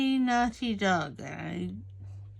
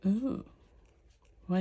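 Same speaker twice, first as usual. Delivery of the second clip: ordinary talk, nothing marked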